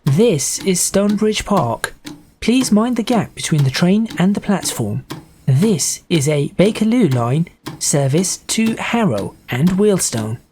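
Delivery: slow, measured station announcement; train announcer. Acted. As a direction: announce each stop carefully, word by word.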